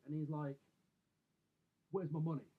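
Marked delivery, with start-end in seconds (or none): 1.9-2.5 louder, confrontational mock demand; retold angry demand. Angry neighbour confronting the narrator in the street, retold angrily. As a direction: imitate a man squaring up for a fight. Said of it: "he looks pissed, right, he's fucking mad dogging me".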